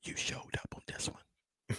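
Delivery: soft unvoiced whisper; whispering on the phone. Real, not acted. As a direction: whisper it, barely audible. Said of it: "Why am I, why am I whispering?".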